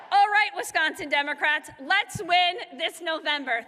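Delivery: loud, emphatic send-off; full-volume campaign finish; closing rally cry. Real, not acted. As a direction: rouse the crowd to go and win.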